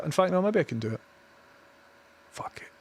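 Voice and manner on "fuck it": breathy whispered fuck it; barely voiced, steeling himself; whispered swear. Real, not acted. Muttering to himself before the risk, whispered.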